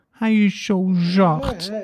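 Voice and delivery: high, slow cooing; tender cooing voice. A narrator's reading. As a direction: coo it to the puppy as you pet it. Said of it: "met een liefkozend stemmetje".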